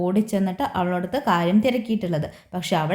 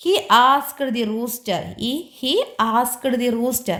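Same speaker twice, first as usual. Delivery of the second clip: drawn-out reading voice; slow story reading; 4 semitones higher, much slower than usual. Shown as a demonstration. Teacher reading the story slowly to children. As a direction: read each word slowly for the class.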